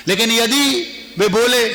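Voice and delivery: usual speaking voice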